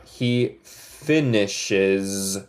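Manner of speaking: "He finishes" stretched very slowly; teacher drilling "He finishes work" slowly; slow model sentence. Shown as a demonstration. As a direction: spell out "He finishes work" word by word. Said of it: "Yes, good job".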